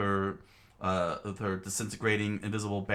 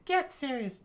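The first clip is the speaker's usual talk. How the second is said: very high stern snap; high scolding voice. Acted. Character scolding the others, high stern voice. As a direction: snap it at her.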